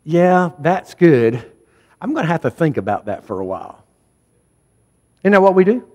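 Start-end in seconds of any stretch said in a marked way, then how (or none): 0.0-3.8 lukewarm brush-off. Retold or imitated. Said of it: "and we say".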